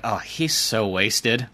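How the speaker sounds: slow, lightly voiced scornful girl; disdainful girl voice. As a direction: drag it out with mild disgust. Girl judging drunk Kyle.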